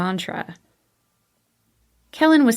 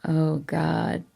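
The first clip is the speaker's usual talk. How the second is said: softer, lightly lower male moan; moaning lover; 3 semitones lower, 4 dB quieter, much slower than usual. A narrator's reading. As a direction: let it slip out, aroused. Aroused Kellen voiced.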